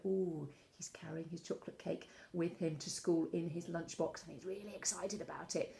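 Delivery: hushed, whispered imitation of a storyteller's excitement; excited whisper. Drama teacher showing pupils a storyteller's act, excited whisper. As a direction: whisper it like a thrilling secret being shown.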